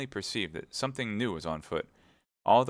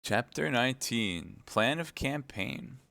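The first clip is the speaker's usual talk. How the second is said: slow, announced heading; chapter title read; 5 semitones higher, slower than usual. A narrator's reading. Narrator introducing the new chapter, slow announcement. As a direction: give the chapter title slowly.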